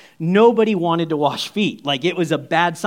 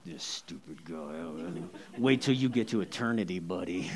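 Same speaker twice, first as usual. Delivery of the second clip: low, very soft grumble under the breath; muttered grudge; 11 semitones lower, 11 dB quieter than usual. Retold or imitated. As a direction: mutter a mock threat to yourself. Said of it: "Do you think he did it like".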